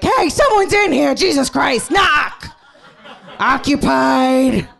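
high, loud shouting mock outrage, laughing; indignant tampon. Tampon shouting at an intruder, high mock outrage. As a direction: yell like someone walked in on you. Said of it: "Tampon's in there like".

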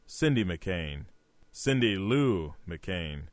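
soft, low and slow; hushed, lingering mock swoon; mock smitten. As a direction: say the name like a smitten admirer. Mock swooning over a crush.

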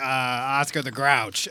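usual speaking voice

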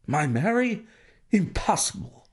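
refusing to believe it; banker's voice breaking into protest; shocked disbelief. A narrator's reading.

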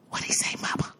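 whispered question to mother. Retold or imitated. A daughter whispering to Mama about the man, unvoiced. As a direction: lean in and ask Mama under your breath. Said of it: "I said".

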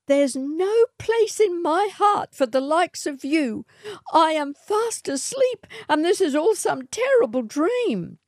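put-on high young woman, defiant; Matilda defiant. A narrator's reading. Matilda rejects the swagman, high and defiant. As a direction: pitch her voice up high, refusing him.

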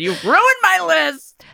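high, drawn-out playful complaint; mock-aggrieved wail. Co-host whose list was ruined, mock-aggrieved wail. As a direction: wail it slowly in pretend outrage.

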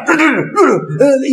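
high rattling mock yapping; imitated barking back. Teacher mocks a person arguing with a dog, imitated yapping. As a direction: yap back at the dog in nonsense noise. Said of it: "you keep on doing like this".

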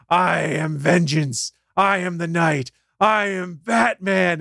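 slow, dramatic superhero declaration; Batman. Retold or imitated. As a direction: deliver it slowly as a heroic proclamation. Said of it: "he launches into the".

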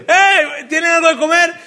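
high-pitched hailing shout; grito de lejos. Acted. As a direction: call out loudly to a distant boat. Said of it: "les pega un grito y les dice". (grito agudo llamando desde la orilla)

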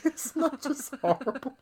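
high-pitched laugh over the words; laughing hard. Real, not acted. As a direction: crack up as you speak. Laughing hard at own story.

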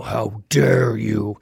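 low, drawn-out booming reproach; angry bellow. Acted. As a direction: bellow it slowly from the clouds, mock-angry. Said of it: "doesn't billow angrily from the clouds and go".

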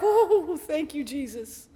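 high ooh sinking into soft thanks; hammed-up relief, fading away; relieved. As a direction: let out comic relief, then drop to a murmur. Relief after professor backs her up.

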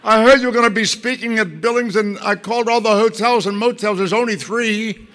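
imitating a proud fan, giggling at the end; excited fan. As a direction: brag breathlessly about tracking him down. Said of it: "She said".

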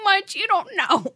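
words squeezed out through high giggles; can barely speak for laughing. Real, not acted. Reading a silly poem to friends, cracking up.

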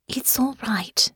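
tender hush; soothing. A narrator's reading. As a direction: reassure in a near whisper. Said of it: "Honor said again".

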